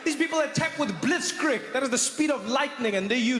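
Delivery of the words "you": normal talking voice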